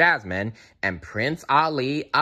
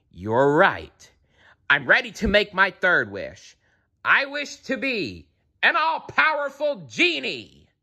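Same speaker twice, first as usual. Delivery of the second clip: gloating villain; 9 semitones higher than usual. A narrator's reading. Jafar makes triumphant wish.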